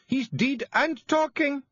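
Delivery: high, slow, loud exasperated call; shouting. A narrator's reading. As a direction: shout down the hole. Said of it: "Jeanie shouted down the hole".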